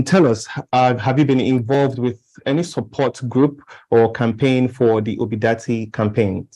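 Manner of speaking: ordinary delivery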